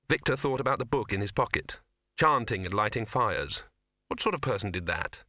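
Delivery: plain reading voice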